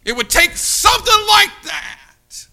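shouted high, mocking disbelief; scornful shouted mockery. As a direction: sneer it out loud like a scoffer. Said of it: "He said so scornfully".

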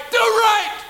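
high, loud, hammered; shouted command. Real, not acted. Preacher to the youth, a high loud shouted order. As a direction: bark the command at full force. Preacher rebukes rowdy church kids.

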